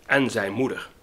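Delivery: lively, lilting poem reading with wide pitch swings; poem recitation. Acted. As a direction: recite a children's verse with emphatic, measured expression. Recites an old children's poem. (gedicht met veel expressie voordragen)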